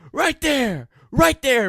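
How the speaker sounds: high, loud pointing call; accusing shout. Retold or imitated. Principal shouting to the dean while pointing out the kid.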